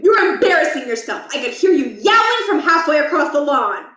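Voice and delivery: stern principal. Acted. Principal scolding.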